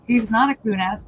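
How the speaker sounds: ordinary talk, nothing marked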